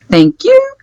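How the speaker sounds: chirpy high thanks; bright mock-sweet thanks; high-pitched thank you. Playing character paying the man.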